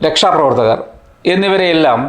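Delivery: ordinary delivery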